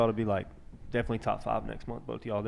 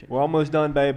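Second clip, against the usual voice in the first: loud, raised call to someone off mic; 5 semitones higher, 10 dB louder than usual. Real, not acted. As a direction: call it over to the caller. Calls out to friend's caller.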